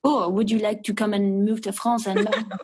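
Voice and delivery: put-on a light, laughing French accent. Bar owner charming her in a French accent. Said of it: "in a very French accent".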